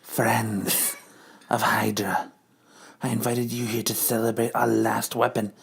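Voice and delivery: low, soft menacing address; villain speech. Acted. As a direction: announce the doomsday plan with quiet menace. Villain addresses her followers.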